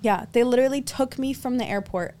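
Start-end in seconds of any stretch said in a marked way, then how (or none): none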